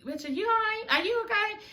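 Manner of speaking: high, soft mock-worried asking; mock concern. Group eyeing Kelly, asking softly high if she's okay. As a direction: voice the group's pitying stare. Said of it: "everybody else looking at you like".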